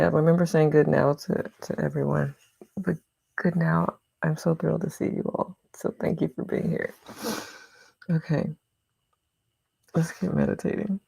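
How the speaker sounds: ordinary delivery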